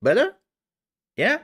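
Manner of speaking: high, loud chirp; squeaky check-in. Pretend. Streamer asking chat if the demo improved, squeaky chirp. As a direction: pop the question out in a high voice.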